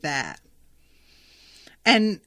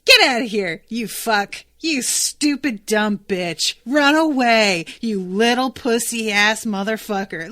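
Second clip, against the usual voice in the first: loud, rowdy cat trash-talking Clore; Mogget taunting; 5 dB louder than usual. Retold or imitated. Mogget the cat jeering at Clore, loud mocking taunts. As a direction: yell insults like a cocky hype man. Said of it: "here comes Mogget right up to Clore, going".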